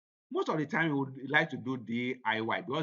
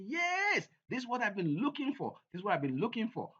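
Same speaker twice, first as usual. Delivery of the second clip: pitched way up, eager customer's yes; a lightly voiced happy customer, high; mock delighted customer; 10 semitones higher than usual. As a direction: play the thrilled buyer who found it.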